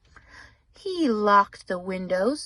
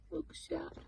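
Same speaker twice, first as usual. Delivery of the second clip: whispered aside; 4 semitones higher, 15 dB quieter than usual. Real, not acted. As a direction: whisper it like a secret cue. Aside to the listening child.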